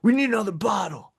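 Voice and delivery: high, fast demanding brat; mock an entitled brat calling for service; bratty prick. Host mimicking the spoiled villain ordering drinks, bratty demand. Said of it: "he's such a prick".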